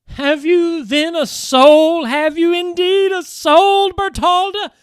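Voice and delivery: loud, high, desperate questioning; anguished cry. Acted. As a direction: shake her friend awake. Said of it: "she cried again and again".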